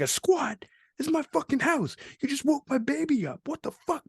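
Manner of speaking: very high mock indignation; imitated outraged resident. Resident whose baby was woken by strangers, high mock outrage. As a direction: protest in a high put-on voice without shouting. Retold father angry at waking baby.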